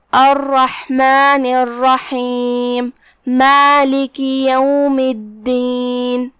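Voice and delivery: drawn-out, melodic tajweed recitation; measured chanting of scripture; slow Quran recitation. Shown as a demonstration. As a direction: recite each word slowly, with tajweed. Demonstrates the recited verses.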